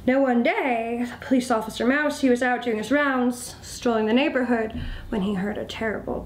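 ordinary delivery, nothing marked

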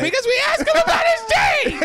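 laughing shout. Real, not acted. Laughing shout mid argument.

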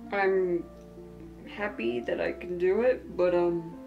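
tired and soft, long pauses; fading drowsy murmur; sleep deprived. Real, not acted. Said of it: "whenever I'm sleep deprived".

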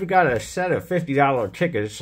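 loud, high, hyped greeting; excited intro. Real, not acted. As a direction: pump up the viewers. Loud energetic channel intro.

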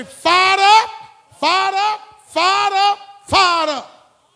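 high, loud chant of fired up; fired-up shout. Shown as a demonstration. Preacher leading the congregation's chant, full shout. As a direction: roar it to get the crowd going. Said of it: "Somebody say".

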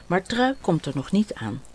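usual speaking voice

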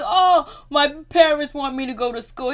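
high, loud fake whine; mock crybaby complaint; mocking imitation. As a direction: wail like a put-upon victim, hammed up. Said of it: "the way he just imitated".

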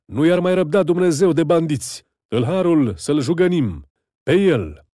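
high, loud furious mob call; angry shout. A narrator's reading. Neighbour rousing the mob against the thief, furious loud shout. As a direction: whip the crowd up to chase him. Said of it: "strigă unul".

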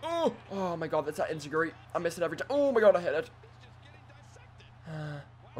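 ordinary delivery

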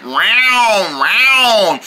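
two falling mock yowls; imitated cat meow. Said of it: "He's gonna go like".

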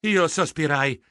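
usual speaking voice

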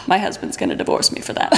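breathy whispered joke; whispered aside. Acted. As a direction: whisper it behind your hand.

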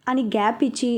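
ordinary delivery

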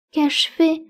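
put-on high, tearful girl's question. A narrator's reading. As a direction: ask it on the edge of tears. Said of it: "demanda-t-elle tout en larmes". (question aiguë, au bord des larmes)

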